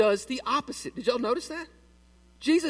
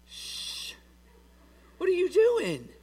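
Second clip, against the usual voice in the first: long shush, then a high-pitched mock-appalled question; acted high-pitched scolding after a shush; alarmed shushing; 10 semitones higher, slower than usual. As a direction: hush them, then ask in high disbelief. Congregation silencing the blunt greeter.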